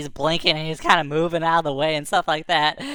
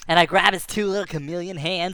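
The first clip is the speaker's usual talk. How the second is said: ordinary delivery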